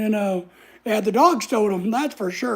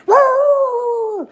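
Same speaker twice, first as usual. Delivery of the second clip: imitated dog roar, much higher and loud; 13 semitones higher, 6 dB louder than usual. Dogs warning off the strangers, imitated roar. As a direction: bark it out like a guard dog.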